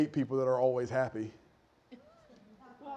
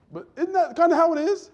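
pressing question; 13 semitones higher, 6 dB louder than usual. Real, not acted. Preacher presses congregation for agreement.

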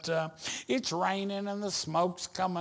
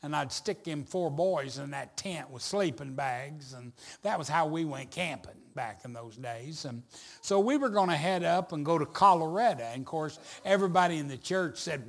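normal talking voice

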